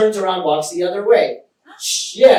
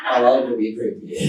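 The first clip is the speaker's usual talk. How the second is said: low-pitched, over-the-top creepy threat; mock menace; 7 semitones lower, 6 dB quieter than usual. Man called a creep imagining his revenge, mock menacing. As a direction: drop low and vow comic revenge. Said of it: "That's how I felt when she called me a creep".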